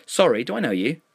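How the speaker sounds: high, lilting question; polite enquiry. A narrator's reading. Narrator replying to a stranger's friend request, high polite enquiry. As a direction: pitch it up in polite puzzlement.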